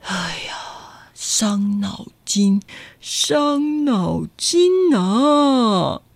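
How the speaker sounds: whispered then very slow fretting; troubled man fretting to himself, whispered then slow; worried sigh. Acted. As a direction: fret to yourself, drawn out. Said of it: "杏仁先生说".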